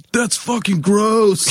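slow, high-pitched heckle; imitated yell. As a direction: holler it like a friend in the crowd. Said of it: "Josh just yelled out".

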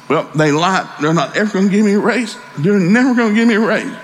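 high-pitched upset daughter, rising; mock tearful complaint. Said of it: "she's crying".

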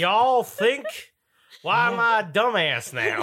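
high, loud hammed-up Southern twang, laughing. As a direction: parody your own voice as a hillbilly. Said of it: "Why did [name] suddenly become a weird redneck bitch?".

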